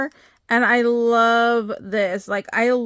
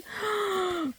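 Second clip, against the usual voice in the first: surprised gasp; 7 semitones higher, 10 dB quieter than usual. Real, not acted. Opening Jackie's package.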